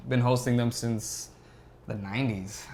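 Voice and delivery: normal talking voice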